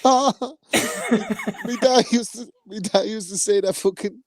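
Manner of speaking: high, giggly, barely able to speak for laughing; fighting off laughter while trying to tell a story; laughing through the words. Real, not acted. To his mates on the show, laughing too hard to get the words out.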